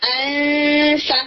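انفا stretched very slowly, the noon hidden under a light فا; tajweed demo of انفا, long nasal hold; ikhfa demo. Shown as a demonstration. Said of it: "جب آپ فا کے ساتھ بولیں گے".

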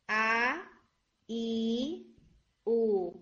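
آ ای او each started with a light glottal catch; light hamza onsets on آ ای او; hamza vowels. Shown as a demonstration. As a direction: repeat the vowels from the throat. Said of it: "یہ حمزہ کی sound ہوگی".

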